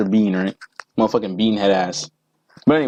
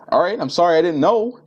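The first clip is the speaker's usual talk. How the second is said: higher, sheepish, backing down; a lightly voiced timid apology, pitched up; mock meek apology; 7 semitones higher than usual. Meek reply to seat bully.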